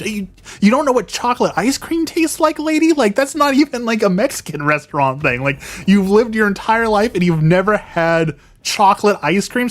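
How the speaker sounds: high, incredulous retort, pitch climbing; exasperated disbelief. Retold or imitated. Vlogger's imagined retort to the lady, incredulous. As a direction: scoff at her in disbelief.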